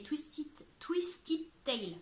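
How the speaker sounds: French-accented English, drawn out at the end; clumsy English accent. Real, not acted. Vlogger reading an English book title to viewers, clumsy English accent. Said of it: "Super l'accent anglais".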